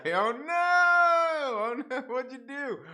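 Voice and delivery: high, loud oh no; playful shock at chat's joke; mock alarm. Real, not acted.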